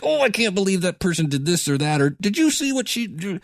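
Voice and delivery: high-pitched, gushing disbelief; mock astonished viewer. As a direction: imitate a fan exclaiming over a TV drama. Imitates gushing TV show viewers.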